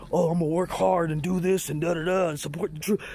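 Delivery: sneering imitation of a naive striver; mocking basic attitude. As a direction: parody a smug, hard-working true believer. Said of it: "that basic bitch attitude of".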